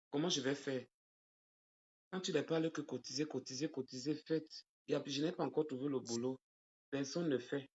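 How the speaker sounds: very soft, away from the audience; hushed phone conversation; private phone aside. Real, not acted. Takes a private phone call mid-livestream.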